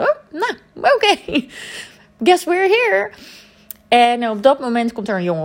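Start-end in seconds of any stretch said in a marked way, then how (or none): 0.0-3.1 high, put-on resigned voice; two friends giving in to the chaos, mock resigned; mock resignation. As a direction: shrug it off in a sing-song voice. Resigned inner thought with friend.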